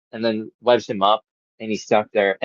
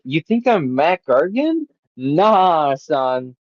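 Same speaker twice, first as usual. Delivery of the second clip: cocky taunt; 4 semitones higher, much slower than usual. Retold or imitated. Acted taunt during a fight.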